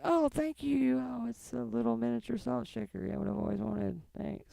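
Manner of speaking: mock-polite thanks for a tiny salt shaker; fake gratitude. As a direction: pretend to love a lame gift. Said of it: "sometimes we're like".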